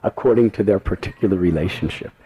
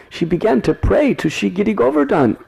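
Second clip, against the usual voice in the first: ordinary delivery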